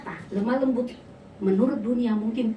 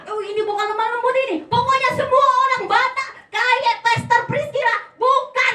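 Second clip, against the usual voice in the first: mock disapproval; 11 semitones higher, 6 dB louder than usual.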